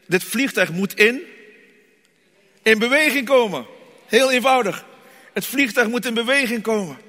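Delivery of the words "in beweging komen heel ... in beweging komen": preaching. Acted. Preaching with rising force.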